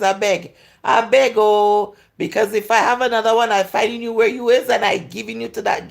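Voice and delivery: loud, fast, hammed-up pleading reply; mock pleading rant. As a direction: beg loudly and quickly, like an offended viewer. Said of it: "they go".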